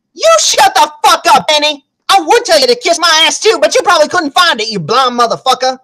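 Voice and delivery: angry retort. Retold or imitated. Woman retorts to man accusing her of stealing, angry comeback. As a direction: fire back an insult, high then settling. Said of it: "so they can actually fight".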